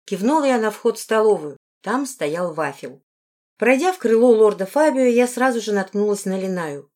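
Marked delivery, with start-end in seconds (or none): none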